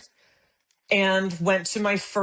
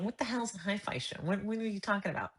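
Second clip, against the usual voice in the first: confused aside; 3 semitones higher, 9 dB quieter than usual. Retold or imitated. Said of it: "And I went".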